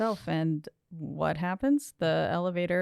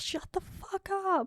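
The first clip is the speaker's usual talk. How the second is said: mock yell; 13 semitones higher, 4 dB quieter than usual. Retelling how she silences the growling noises, high mock yell. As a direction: imitate a yell in a much higher voice. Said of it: "she just yells".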